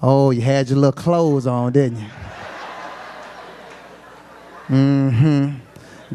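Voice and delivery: mock sweet sarcasm, laughing through it; sarcastic angry wife. Wife scolds husband back from the club, low sarcastic voice. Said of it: "she's sitting there".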